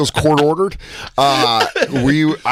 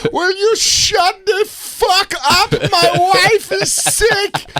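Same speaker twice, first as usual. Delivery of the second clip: much higher, very slow squeaky voice; imitating prank caller; much slower than usual. Prank caller repeating the bit. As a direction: draw every word out high. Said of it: "He goes".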